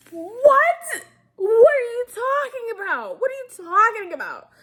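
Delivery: high, loud incredulous squeal; mock disbelief. Recapper reacting to the boss line, mock disbelief. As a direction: shriek it in hammed-up disbelief.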